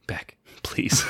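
whispered plea to behave; whispered, pleading aside; hushed reproach. Acted. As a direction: shush your partner under your breath. Hushing a partner mid-interview.

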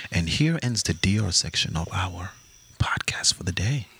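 low, near-whispered closing announcement; mock hushed sign-off. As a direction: sink into a pretend solemn outro.

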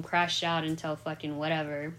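usual speaking voice

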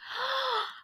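high, sharp gasp; acted startled gasp. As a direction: suck in air in shock. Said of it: "peeked inside the room and gasped".

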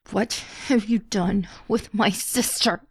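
forced out through clenched teeth; strained, slow, teeth clenched; through gritted teeth. A narrator's reading. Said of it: "forced out through gritted teeth".

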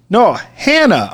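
"Hannah" high and drawn out; slow, high "Hannah" with a clear H; corrected name. Retold or imitated. German lady correcting her name, stressed H in "Hannah". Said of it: "very clear German accent".

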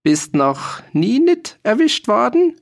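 put-on slow, high, jeering old-man voice; high old farmer taunt. A narrator's reading. Old farmer jeers at the soldier. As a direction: drag out the taunt.

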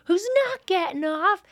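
high, fast rock star rave; quoting the rock star, a lightly voiced rock god; Almost Famous quote. To friend, quoting the rock star from Almost Famous, high and excited. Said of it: "And he's like".

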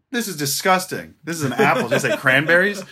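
can barely keep it together delivering the punchline; laughing through it. Acted. Comedian telling a bar joke to his co-host, laughing mid-line.

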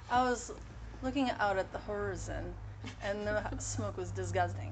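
put-on French-accented horizon, stressed on the first syllable; French dad's accent. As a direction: imitate a French father saying horizon and disgusting. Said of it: "imitating my dad's accent".

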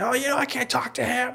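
high, squeaky mock excuse; whiny brother, imitated. Brother explains about expelled dad, whiny imitation. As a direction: mimic a brother making excuses.

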